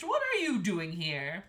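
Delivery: loud, astonished question; astonished king. A narrator's reading. King finding the daughter here, astonished. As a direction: ask it loudly in disbelief.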